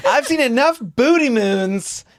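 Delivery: high-pitched imitation of an indignant rant; mock rant. Co-host imitating partner's overheard rant, high mock outrage. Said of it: "you were on a rant about".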